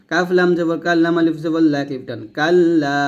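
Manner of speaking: ordinary talk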